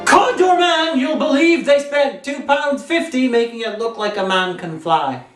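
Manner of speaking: ordinary delivery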